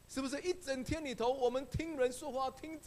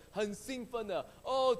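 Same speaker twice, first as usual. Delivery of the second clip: ordinary talk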